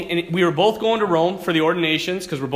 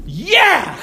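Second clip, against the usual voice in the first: sudden high gasping shout for air; desperate yell; 11 semitones higher, 8 dB louder than usual. Retold or imitated. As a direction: shout it as you burst up from the water.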